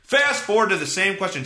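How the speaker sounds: ordinary delivery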